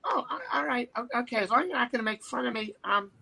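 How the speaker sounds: high, hesitant host impersonation; dithering impersonated reluctance; Jay Leno impression. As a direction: hem and haw in the high celebrity voice. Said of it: "Hey, it's Jay Leno".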